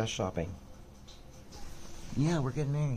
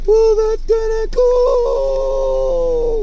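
slow, much higher, much louder yell; drawn-out shout; 12 dB louder, much slower than usual. Real, not acted. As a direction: bellow each word slowly. Repeats line, then shouts it.

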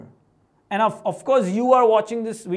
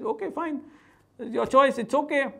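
breezy retold acceptance; 6 semitones higher than usual. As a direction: let it go lightly, unbothered. Retold unbothered reply about failing.

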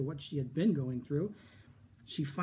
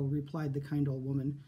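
ordinary delivery

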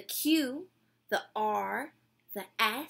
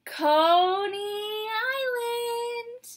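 high, loud and very slow announcer call; stop announcement; 9 semitones higher, 8 dB louder, much slower than usual. Pretend. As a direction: stretch the station name over the platform.

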